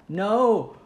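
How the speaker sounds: high loud cry; yelling. Real, not acted. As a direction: yell in dismay. Teammate goes down.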